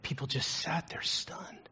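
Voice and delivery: whispered and slow; hushed. Real, not acted. Preacher telling the congregation of the stunned room, whispered. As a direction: let it drop to a whisper.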